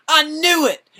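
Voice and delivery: high, loud triumphant cry; gleeful. Retold or imitated. Thor seeing Captain America lift the hammer, loud gleeful cry. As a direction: shout it like you saw it coming. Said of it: "And Thor was like".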